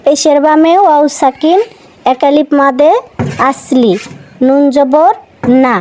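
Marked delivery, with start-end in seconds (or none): none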